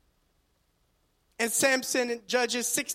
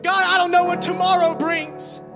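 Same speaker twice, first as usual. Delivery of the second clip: raised, earnest praying voice; fervent prayer; 3 semitones higher than usual. Acted. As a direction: pray it out with conviction. Preacher models a prayer.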